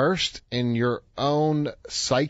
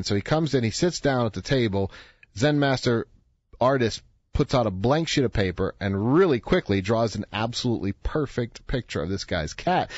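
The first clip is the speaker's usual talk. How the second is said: ordinary talk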